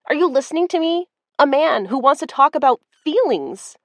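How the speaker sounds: high, fast and rising; shrill-edged exasperation; exasperated. Acted. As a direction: insist in disbelief. Misty exasperated, voice climbing.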